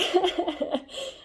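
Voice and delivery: high laugh breaking out; sudden high-pitched laugh; bright laugh. Real, not acted. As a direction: burst out laughing at yourself. Laughing at Animorphs memory.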